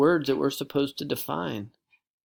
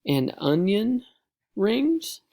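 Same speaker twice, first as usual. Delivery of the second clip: rising to a very high squeak; girl spotting onion rings through new glasses; delighted surprise; 9 semitones higher, 4 dB louder, much slower than usual. Acted. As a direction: gasp with joy at onion rings. Delighted discovery.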